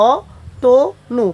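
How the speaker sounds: অতনু drawn out very slowly as "o-to-nu"; অতনু spoken very slowly, each syllable clear; pronunciation demo. Shown as a demonstration. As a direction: stretch out অতনু syllable by syllable, open o sound. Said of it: "উচ্চারণ হবে অ, ত, ও".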